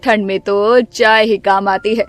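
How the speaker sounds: low, bossy mother-in-law voice, stretched call; stern mother-in-law. Acted. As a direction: play the grumpy saas calling her daughter-in-law.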